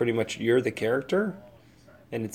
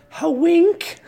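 high squeaky "ha wink"; mock wink; 6 dB louder than usual. Podcaster asking listeners for a favor, cheeky squeak. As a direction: tack on a cheeky jokey wink.

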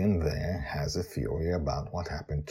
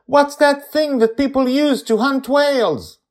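put-on high excited kid calling out loud; child shouting; 13 dB louder than usual. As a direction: yell like an eager little girl. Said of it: "Joy shouts".

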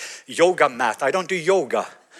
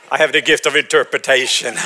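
mock grand; 7 dB louder than usual. Joking about interpretation gift.

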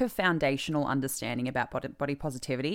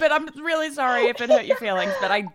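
mock apology voice; 10 semitones higher, 5 dB louder, faster than usual. Host mimics the apology voice.

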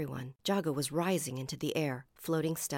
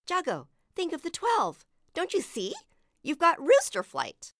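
high, raised calling voice; 13 semitones higher, 7 dB louder than usual. A narrator's reading. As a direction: call up through cupped hands. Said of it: "hands cupped around her mouth".

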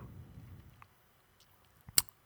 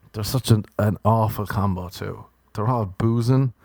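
normal talking voice

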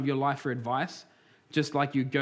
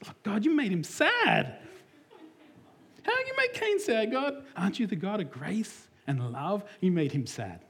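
high, whining plea to God, hammed up; sarcastic pleading voice; mock whiny complaint; 4 semitones higher than usual. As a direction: whine at God like an indignant child. Mocking readers who pity Cain.

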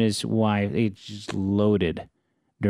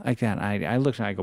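ordinary talk

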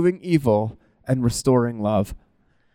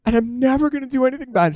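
high-pitched mock-tearful mom; imitated crying mom; 10 semitones higher than usual. Mom confessing in a video about yelling at her kid, mock tearful. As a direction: mimic a weepy mom's confession, pitched way up. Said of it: "She's, like, crying".

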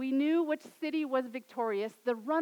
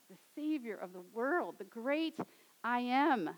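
usual speaking voice

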